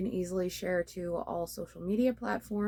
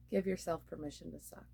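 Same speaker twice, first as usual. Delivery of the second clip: a sigh, then the words much softer; 3 semitones lower, 9 dB quieter than usual. Real, not acted. Vlogger reminding herself of her motto, soft sigh. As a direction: breathe out and say it softly to yourself.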